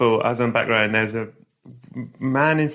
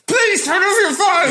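very high, drawn-out exasperated yell; sudden outburst; 4 dB louder, slower than usual. Retold or imitated. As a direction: explode out of nowhere at the phone user. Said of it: "I went to a hundred out of nowhere".

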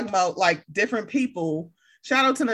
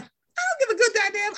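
high-pitched, indignant dismissal; retold defiant retort; 11 semitones higher than usual. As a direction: replay your retort, pitch soaring. Retells her retort to upset woman.

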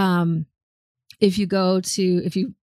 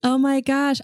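higher-pitched, thrilled reaction; real excitement; 7 semitones higher than usual. Real, not acted. Co-host hears the show she just watched, excited. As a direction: gush in happy recognition, pitch lifted.

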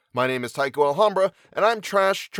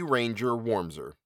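ordinary delivery

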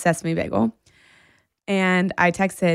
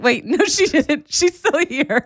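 high, slower, laughing through it; laughing realization; 8 semitones higher, 3 dB louder, slower than usual. Retold or imitated. Telling her friend Amy's flight never left, laughing. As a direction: catch yourself mid-mistake and laugh.

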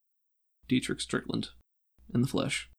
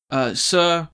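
calling sir; 4 semitones higher, 7 dB louder than usual. Acted. Assistant calls her boss.

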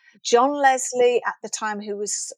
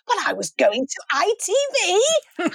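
laughing through the words; 8 semitones higher than usual. Real, not acted. Guest laughing to host about defecting to ITV. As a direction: giggle as you admit it.

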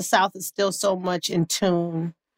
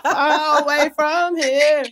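slow, higher D C crowd singalong; D C crowd accent; 5 semitones higher, slower than usual. Retold or imitated. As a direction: drag it out like a hometown D C crowd. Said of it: "I'll be like".

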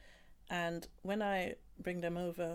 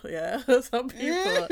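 laughing; 6 semitones higher, 11 dB louder, slower than usual. Real, not acted.

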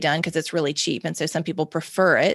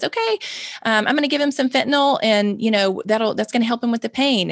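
bright, high, chirpy nurse voice; cheerful; 4 semitones higher than usual. Retold or imitated. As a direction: chirp it out casually, like it's nothing. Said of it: "she just very cheerfully says".

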